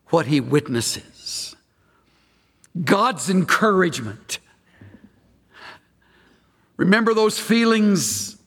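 ordinary talk

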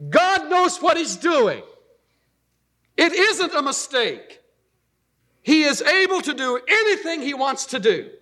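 high, emphatic declaration to yourself; loud self-talk. Acted. Pastor telling himself to trust God, loud self-talk. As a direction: drive it home loudly, pitched well up. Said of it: "I say it loud so I'll be sure and hear".

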